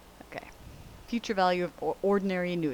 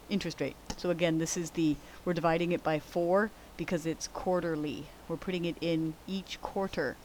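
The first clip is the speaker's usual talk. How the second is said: ordinary talk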